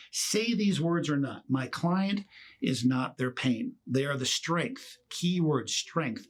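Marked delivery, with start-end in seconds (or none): 1.5-5.0 slow, weighty, every word set down; slow deliberate statement. Shown as a demonstration. Coaching lawyers on the message to jurors, slow and weighty. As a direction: lean hard on strength, letting it land. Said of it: "Your message to your jurors should always be this".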